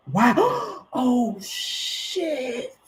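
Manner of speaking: a sudden high gasping wow, then a breathy oh shit; stunned surprise. Real, not acted. Tarot reader reacting to a card, stunned. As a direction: caught off guard, the breath knocked out.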